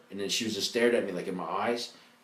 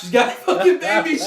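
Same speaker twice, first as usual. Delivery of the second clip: put-on much higher, louder kid blurting news; excited announcement; 11 dB louder than usual. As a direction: shout the news to the whole class. Telling classmates excitedly.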